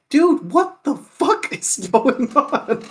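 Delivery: high-pitched, laughing mock bewilderment; drawn out, breaking into a laugh midway; laughing disbelief. Retells own bewilderment, laughing through it.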